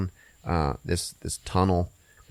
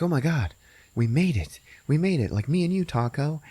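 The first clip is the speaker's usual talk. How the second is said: relieved bun; 7 semitones higher than usual. Retold or imitated. Bun relieved after escape.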